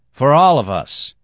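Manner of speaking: loud and hard toast. Acted. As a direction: call it out hard.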